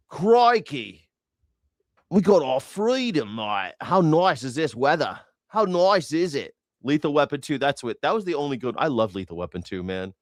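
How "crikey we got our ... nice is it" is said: mock Australian. Mocking Mel Gibson as Australian.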